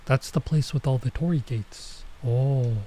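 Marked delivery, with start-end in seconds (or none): none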